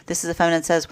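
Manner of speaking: usual speaking voice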